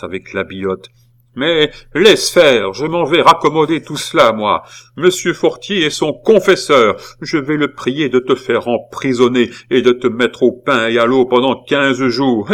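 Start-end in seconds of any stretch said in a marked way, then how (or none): 1.3-12.5 higher, louder scolding tirade; authoritative threat. A narrator's reading. Aunt threatening her nephew with prison, authoritative. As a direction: lay down the punishment with conviction. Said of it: "avec une autorité et une conviction".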